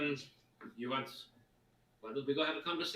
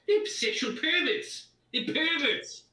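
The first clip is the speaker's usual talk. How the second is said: shouting; 8 dB louder than usual. Acted. Character shouts accusation.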